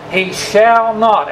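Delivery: "he shall not" drawn out slowly, each word weighted; emphasized not. Shown as a demonstration. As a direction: lean on "shall" and "not", slow and deliberate. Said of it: "And I emphasize that".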